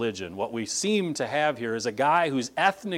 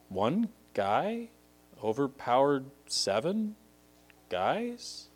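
softer, halting, rising disbelief; hushed incredulity; 2 semitones higher, 3 dB quieter, slower than usual. Real, not acted. Preacher marvels at one man beating seven, hushed incredulity. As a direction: question each piece, lifting at the end.

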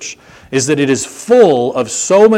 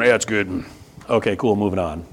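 brushing it off; 5 semitones lower, 5 dB quieter than usual. Acted. Mimics a perfunctory marriage check-in.

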